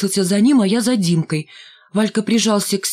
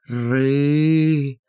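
low mock tiger growl; drawn-out low growl; 5 semitones lower than usual. A narrator's reading. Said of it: "и зарычал на меня".

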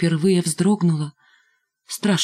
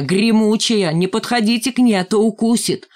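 higher, mock-alarmed warning; taunting warning; 4 semitones higher, 3 dB louder than usual. A narrator's reading. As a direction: warn the little kids off, teasing. Рыжий taunts, warns small children. (дразнящее предостережение)

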